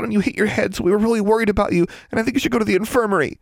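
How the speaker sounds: put-on high, fast, breathless girl's babble; frantic burbling. A narrator's reading. Anxious friend babbles the whole story to the dazed girl, high and fast. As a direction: pour it all out in a panicked rush. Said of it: "Anna's frantic burbling".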